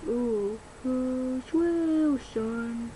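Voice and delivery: ordinary talk, nothing marked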